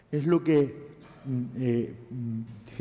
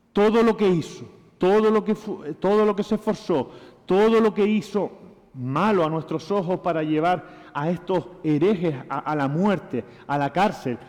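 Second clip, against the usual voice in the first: louder, higher hammering repetition; fervent preaching; 3 semitones higher, 3 dB louder than usual. Acted. Pastor preaching on Paul's misdeeds to the church, fervent. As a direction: pound each phrase home from the pulpit.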